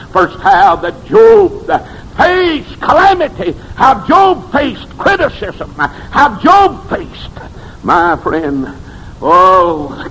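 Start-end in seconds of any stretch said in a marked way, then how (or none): 0.1-7.4 high, slow sermon whoop; preaching at full force. Acted. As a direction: chant it out high and drawn.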